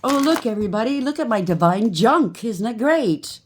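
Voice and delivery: high, loud, hammed-up bragging voice; mocking boast. Mocking the fake spiritual people, loud hammed-up bragging. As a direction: show off like a smug fake guru.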